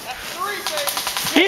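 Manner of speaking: high, gleeful boasting; excited hype. Real, not acted. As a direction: crow about the score. Celebrating a third kill.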